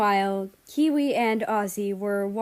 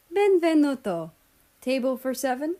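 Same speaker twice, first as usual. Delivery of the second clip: hammed-up Italian waiter greeting, higher and louder; Italian accent; 5 semitones higher, 4 dB louder than usual. A narrator's reading. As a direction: welcome guests with a light Italian accent. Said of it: "spoke in an Italian accent".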